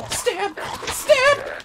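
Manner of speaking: squeaky high battle cry; high-pitched stab. Acted. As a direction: shriek it way up high. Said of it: "I can still do the high-pitched ones".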